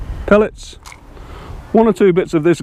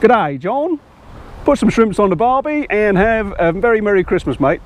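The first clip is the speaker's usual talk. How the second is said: higher-pitched, badly faked Australian accent; rubbish Aussie accent; 4 semitones higher than usual. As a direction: do a hammy Aussie greeting to a mate. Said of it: "that's my rubbish, uh, impression of a, an Aussie accent".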